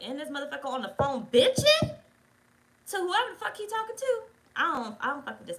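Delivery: a loud, high burst of outrage, peaking mid-run; fed-up fuming. Retold or imitated. Woman fumes to herself while her date talks on the phone, outraged. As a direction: vent how done you are with him. Fuming inner reaction to his call.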